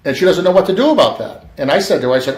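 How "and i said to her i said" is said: plain reading voice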